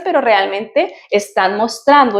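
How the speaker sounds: ordinary talk, nothing marked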